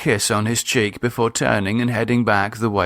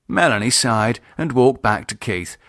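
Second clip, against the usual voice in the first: plain reading voice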